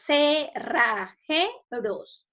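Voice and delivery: "Cerrajeros" drawn out syllable by syllable; slow, separated beats on "Cerrajeros"; split into syllables. Shown as a demonstration. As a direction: say "Cerrajeros" very slowly as ce, rra, je, ros. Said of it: "Separemos en sílabas".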